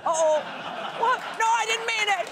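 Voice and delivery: high, panicked backpedal; flustered parent. Acted. Parent caught cheering the wrong phrase, flustered mock panic. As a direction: take it back in a squeaky mock panic.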